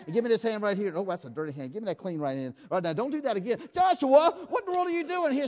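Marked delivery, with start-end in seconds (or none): 3.7-5.5 high, loud mock scolding; hammed-up disbelief at the kid; mock exasperation. Scolding prank volunteer.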